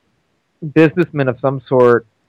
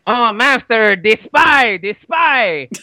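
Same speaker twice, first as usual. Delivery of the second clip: higher-pitched henchman impression; Knick-Knack; 5 semitones higher, much slower than usual. Henchman warning his master of the spy, put-on urgent cry. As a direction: alert your master in an accented put-on voice. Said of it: "as the role of Knick-Knack".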